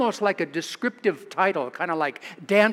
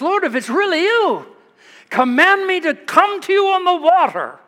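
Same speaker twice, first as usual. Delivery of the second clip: much higher and louder, bold plea; eager Peter; 12 semitones higher, 7 dB louder than usual. Acted. Peter begs Jesus from the boat, eager and loud. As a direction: Peter calls out across the water, excited.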